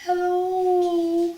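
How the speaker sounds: tiny high goblin hello, drawn out; little voice. Acted. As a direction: call out in a small, high-pitched voice. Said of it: "he heard a voice, a little voice".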